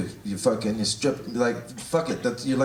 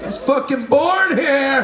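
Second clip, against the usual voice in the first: half-laughing outraged yell, higher; mock indignant shout; 7 semitones higher, 4 dB louder, slower than usual. Comic to Steve about the lineup, a laughing mock-outraged shout.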